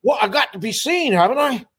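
high-pitched retort in character. Retold or imitated. Drummer answers the guys' question in the dressing room, high impersonated voice.

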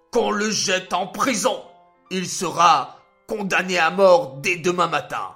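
high, loud furious outburst; furious king. A narrator's reading. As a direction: rage like an outraged king. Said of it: "devint rouge de colère".

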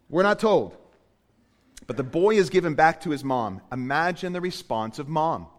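ordinary talk

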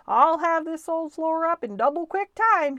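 put-on loud, high, gung-ho boy; eager. George starting to rip up the pantry floor, loud and eager. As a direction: announce it loudly with great eagerness.

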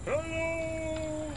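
eerie hail. Acted. Hitchhiker calls out to driver.

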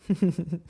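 short self-amused chuckle; small chuckle. Real, not acted. Teacher finds the demo difficult, small chuckle. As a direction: laugh briefly at your own attempt.

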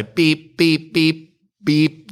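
high, punchy beeps; quick high horn-like "beep" noises; imitated beeping. Storyteller mimics someone beeping at people, high imitated beeps.

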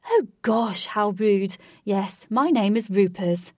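loud posh self-introduction; posh accent. A narrator's reading. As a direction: exclaim heartily in a posh accent. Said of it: "He said in a posh accent".

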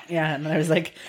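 ordinary talk, nothing marked

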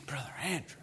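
mock shocked whisper. Congregant to Brother Andrew, a mock shocked whisper. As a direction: whisper it like a scandalized pew-sitter. Imagined listener objects.

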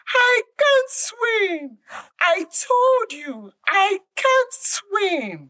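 very high, slow, desperate wail; panicked cry. Acted. Monkey plunged underwater, wailing that he can't swim. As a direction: cry out in terror as you sink. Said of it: "The monkey cried out".

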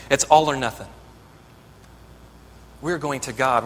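ordinary talk

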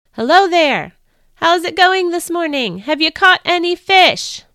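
high, loud friendly hail from the shore; calling out. Acted. Stranger on shore calls out to the fishermen, loud and friendly. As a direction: call out across the water to the boat.

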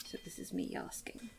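breathy whisper to a god. Acted. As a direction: whisper the plea, almost unvoiced. Hushed prayer to god.

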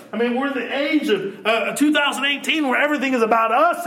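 normal talking voice

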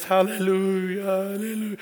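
imitating dad. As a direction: echo the father's tearful hallelujahs, lightly hammed. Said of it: "he's over there like this".

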